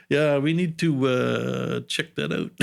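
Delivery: imitating first officer. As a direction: put on the Swedish first officer's voice. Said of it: "first officer's like".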